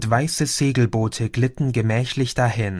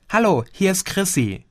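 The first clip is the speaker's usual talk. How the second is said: put-on a lightly higher young woman's voice; Chrissy on the phone; 7 semitones higher than usual. Cousin Chrissy calls Gio, lightly voiced higher. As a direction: play the cousin calling, a bit lifted.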